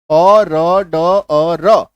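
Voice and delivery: very slow, ओ र ड अ र each drawn out; sound by sound. Shown as a demonstration. Teacher breaking the word down for students, ओ र ड अ र slowly separated. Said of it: "इसका उच्चारण होता है".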